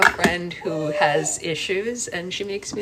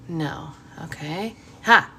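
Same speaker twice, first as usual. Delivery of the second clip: ordinary delivery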